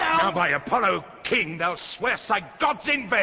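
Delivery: standing up to the king, yelling; defiant shout. Acted. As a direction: throw it back at him, loud and high. Kent shouting back at Lear.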